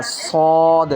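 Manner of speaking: a weighty صاد; heavy full saad. Shown as a demonstration. Tutor demonstrating heavy صاد to a student. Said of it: "اس کو پر کر کے پڑھنا ہے".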